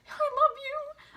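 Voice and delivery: high-pitched gushing declaration; mock adoration. Casting director swooning over an auditioner, mock adoring. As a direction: gush it high, like an instant fan. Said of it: "I'm just like".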